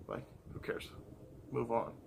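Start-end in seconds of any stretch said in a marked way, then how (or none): 0.1-0.9 voiceless repeat of who cares; whispered. Real, not acted. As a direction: drop to a whisper. Second take whispered.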